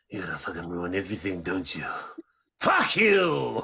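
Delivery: low fast mutter building to a shouted curse; angry Jake. Pretend. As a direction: snarl it, then blow up. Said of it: "an angry Jake".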